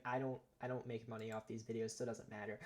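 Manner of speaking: ordinary delivery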